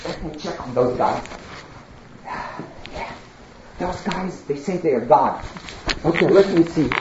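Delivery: stealthy, hushed and low; sneaky murmur. Acted. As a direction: talk to yourself while sneaking at night. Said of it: "he will sneak at night".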